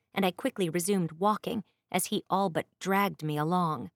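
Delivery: usual speaking voice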